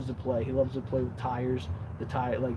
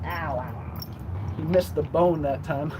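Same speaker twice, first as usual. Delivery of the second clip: usual speaking voice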